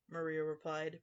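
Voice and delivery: ordinary delivery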